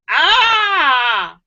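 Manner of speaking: high, sharp mock cry of the wiped rabbit; mock scream. Telling the bear and rabbit joke, rabbit's mock scream. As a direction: yelp as if grabbed without warning.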